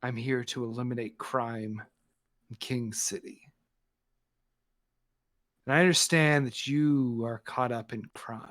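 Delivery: a hint of a cold heroine; Scarlet Scorpion.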